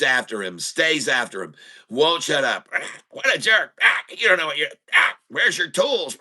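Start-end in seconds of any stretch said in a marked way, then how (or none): 2.7-6.2 higher, squawky parrot voice mocking the worker; squawking parrot. The parrot keeps heckling the working repairman in a higher squawk.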